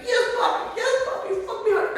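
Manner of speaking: retold screaming, played for laughs; mock screaming woman. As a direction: squeal it in a high mock moan, laughing. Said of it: "she was just screaming".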